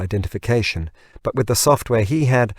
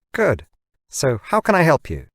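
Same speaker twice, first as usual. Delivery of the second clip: higher chirpy machine answer; assistant voice; 6 semitones higher than usual. Acted. Hologram assistant answers James, higher and chirpy. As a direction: answer brightly like the gadget.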